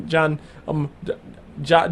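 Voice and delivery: normal talking voice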